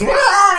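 sudden loud, high yell; mock possessed roar. Possessed man jumps up and scares her, mock roar. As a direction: burst out roaring to startle. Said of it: "and jumps up and scares her again".

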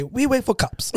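high, put-on Brazilian accent. Teller imitates the husband waiting for police, high mock Brazilian accent. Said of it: "that was my Brazilian voice".

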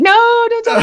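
high laughing no, don't tell me; laughing protest. Retold or imitated. Dodging spoilers from fans, high laughing plea. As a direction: squeal it through a laugh. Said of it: "I'm like".

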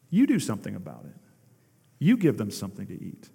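lightly voiced God, low and quick; a low reply, dropping away at the ends. God answering our prayers, low-voiced instruction. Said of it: "what is God saying to us?".

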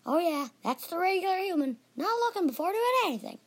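high-pitched sarcastic little leprechaun; small, peculiar voice. Acted. Leprechaun to apologizing human, small peculiar voice. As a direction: grumble high, in a tiny creature's voice. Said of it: "peculiar voice".

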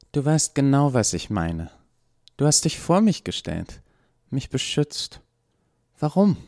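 insistent Eva. A narrator's reading. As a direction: push as Eva, demanding an answer.

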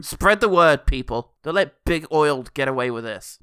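loud call to the masses; rally the crowd in mock earnest; loud, hammed-up campaigner's appeal; mock rallying cry.

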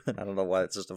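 usual speaking voice

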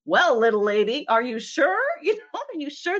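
mock condescending voice, high and laughing; patronizing man. Mocking the mansplainer's doubt, laughing patronizing mimicry. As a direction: tease the smug 'are you sure'. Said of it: "That's a much better approach than".

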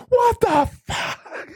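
very high, slow, shocked squeal; mock stunned crowd. Onlookers stunned as the clown gets punched, in a very high, slow squeal. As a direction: stretch out the disbelief. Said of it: "everybody's like".

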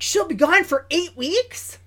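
mock surprised dad. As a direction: play the dad perking up at the news. Acting the dad's eager question.

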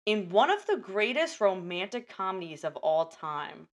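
dramatic, paused movie-trailer read; trailer voiceover. Acted. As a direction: sell it like a trailer.

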